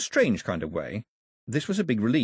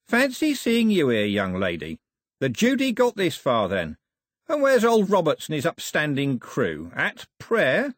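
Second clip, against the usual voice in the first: higher, loud, mock-friendly villain; jeering rogue sailor; 6 semitones higher, 4 dB louder than usual. First Mate Cox greets Daphne like a long-lost friend, mock-friendly rogue. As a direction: greet them with oily, rough-spoken cheer.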